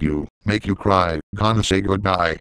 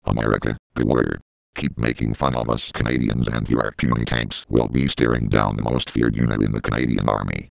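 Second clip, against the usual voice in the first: menacing army announcer; 10 semitones lower than usual. Acted. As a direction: warn America like a war broadcast. Deep threatening military announcement.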